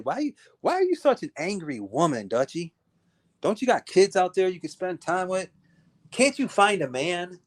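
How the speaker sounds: normal talking voice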